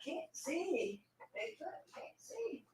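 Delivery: high, faint voice from away; far off. Real, not acted. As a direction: call out thinly from a distance, off the microphone. Went off to fetch glasses.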